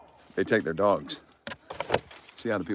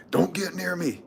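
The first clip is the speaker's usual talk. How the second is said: high-pitched imitated cry; mock scream; 10 semitones higher, slower than usual. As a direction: mimic a man screaming to keep someone back. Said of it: "You just screamed at me".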